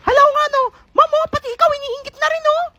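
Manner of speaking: put-on high kid voice complaining to ma'am; high whiny student; whiny child. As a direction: complain to the teacher like a little kid. Child whining to teacher.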